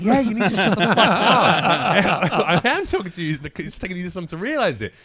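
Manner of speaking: loud, fast, high retort with a laugh; retold snap. Comic snaps back at heckler, loud and fast. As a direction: fire it back at her. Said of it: "And I'm like".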